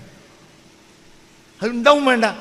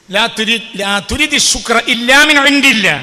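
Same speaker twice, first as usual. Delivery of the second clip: say it louder; 4 semitones higher, 7 dB louder than usual. Shown as a demonstration. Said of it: "ഉറക്കെ".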